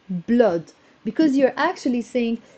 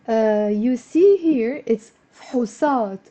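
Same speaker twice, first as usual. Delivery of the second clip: ordinary talk, nothing marked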